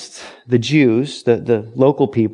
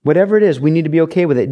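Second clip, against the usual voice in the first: ordinary talk, nothing marked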